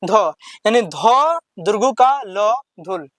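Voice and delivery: ध दुर्गु का ल धुल drawn out very slowly; slow spelling. Shown as a demonstration. Teacher to pupils, slow spelled-out reading. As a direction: stretch each syllable of धुल. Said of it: "कौन सा है?".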